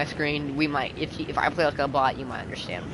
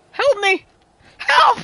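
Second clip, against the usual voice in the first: high screaming for help; panicked scream; 12 dB louder, much slower than usual. Real, not acted. Panicked call in game.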